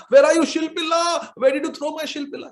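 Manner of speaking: high, loud desperate calling; frantic shout. Acted. Bride calling out for her lost stone, frantic shout. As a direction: cry out into the dark river.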